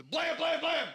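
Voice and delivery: high loud blam blam; mock gunshots. Gunman in Parliament fires, mock gunshot blams. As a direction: bark out fake gunfire.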